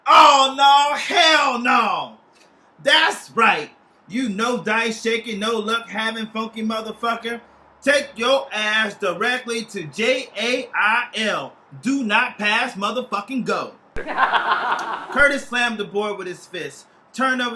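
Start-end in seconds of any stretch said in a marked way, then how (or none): 0.1-2.2 loud cry of protest, much louder and higher; shout. Acted. Character reacts to landing in jail. 2.8-13.7 taunting. Acted. Character taunts friend sent to jail. 13.9-15.1 short mocking laugh. Taunting laugh after jail card.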